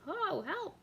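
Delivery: high, loud call for help; queen calling out in terror; cry for help. Acted. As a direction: yelp out in fear. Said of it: "she calls for help".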